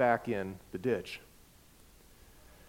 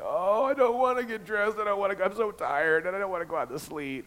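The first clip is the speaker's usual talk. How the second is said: high, whining reluctance, dragging out I don't wanna; whiny complaint; 7 semitones higher than usual. Retold or imitated. Tired husband whining to his wife about going out into the sleet. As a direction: whine like a tired kid who won't get up.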